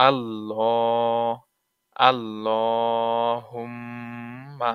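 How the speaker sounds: আল্লাহুম্মা drawn out very slowly with ghunnah on the mim; Quranic recitation. Shown as a demonstration. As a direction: chant আল্লাহুম্মা slowly, nasal hum on mim. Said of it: "তাহলে কি হলো?".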